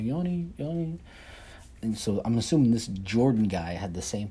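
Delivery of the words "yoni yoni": high teasing singsong; mock taunting. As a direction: tease the name like a schoolkid. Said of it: "they'd be like".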